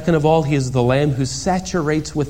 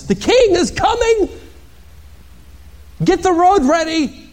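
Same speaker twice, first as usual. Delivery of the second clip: herald's proclamation. Pretend. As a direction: announce it to the whole town. John proclaims the king's arrival.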